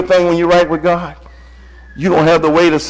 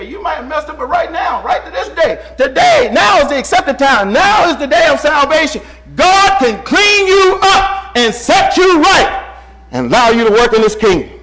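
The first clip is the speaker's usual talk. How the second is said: building sermon climax; 8 semitones higher than usual. Acted. Preacher urging sinners that now is the time, building to a shout.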